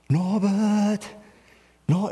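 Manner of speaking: a lightly voiced sing-song call from the kitchen; wife's calling voice. Pastor imitating his wife calling him from the kitchen. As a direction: call him to take out the trash. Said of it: "she'll make that voice from the kitchen".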